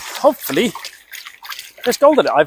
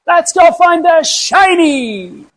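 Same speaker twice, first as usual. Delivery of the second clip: excited catchphrase; 10 semitones higher, much slower than usual. Acted. Catchphrase sign-off, stretched out.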